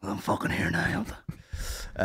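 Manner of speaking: softly sarcastic to the apologizing comic; deadpan mutter. Retold or imitated. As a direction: mutter it under the breath. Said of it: "I'm just sitting there like".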